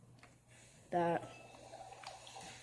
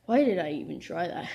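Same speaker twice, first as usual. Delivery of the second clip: normal talking voice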